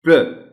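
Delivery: "प्र" loud and high, clipped alone; syllable demo. Shown as a demonstration. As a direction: hit "प्र" sharply. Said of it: "प्रोसेस नहीं कहा जा सकता".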